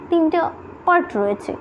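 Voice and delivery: ordinary talk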